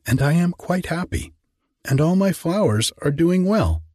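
a simple grateful little gardener; Hans, meek gardener. A narrator's reading. Hans voiced higher throughout.

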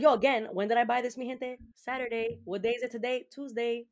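agitated disbelief. Retold or imitated. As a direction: rant it out in disbelief. Retold realizing cereal eaten.

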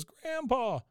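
high mock-anguished boy; Bobby. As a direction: cry out for grandpa in a put-on child voice. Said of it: "He goes".

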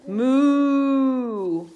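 playful moo for the toddlers; mooing cow. Acted. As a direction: moo like a friendly cartoon cow. Reading, cow sound for babies.

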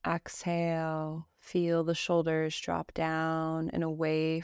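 slow, even meditation voice with long pauses; calm Yoga Nidra guidance, evenly pitched; guided meditation. Acted. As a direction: guide them gently through breath and body, unhurried.

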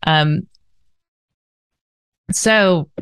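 sipping water between words; while sipping a drink. Real, not acted. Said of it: "forgive me as I drink water through this episode".

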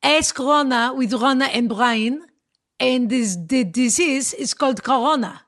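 Rona said Roh-na in an Israeli accent. Shown as a demonstration. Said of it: "with an Israeli accent".

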